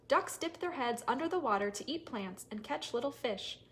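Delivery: ordinary delivery, nothing marked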